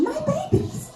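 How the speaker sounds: high squealy fangirl coo; gushing fangirl. Retold or imitated. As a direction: coo it like an obsessed fan. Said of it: "the expression".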